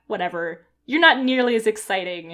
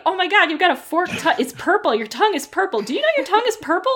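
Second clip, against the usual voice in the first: high squealing amazement; amazed kid voice; 8 semitones higher, 5 dB louder, faster than usual. Acted. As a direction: gush like a wide-eyed kid. Kids amazed at snake.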